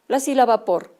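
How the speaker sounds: normal talking voice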